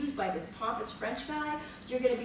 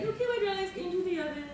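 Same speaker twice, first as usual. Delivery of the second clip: mock director; 8 semitones higher, faster than usual. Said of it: "It's like".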